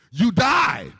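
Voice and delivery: a sharp shouted warning; sudden shout. Real, not acted. Preacher shouting a blunt warning at the congregation, loud. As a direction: blurt it out loud and high.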